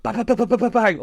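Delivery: rapid-fire high-pitched bangs; imitated banging. Imitates kids banging on bus stop.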